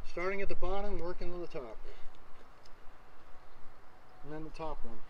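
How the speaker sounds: muffled behind mask; through a gas mask. Real, not acted. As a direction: voice through respirator. Seen 2: gas mask on face throughout; reference frames show unmasked face.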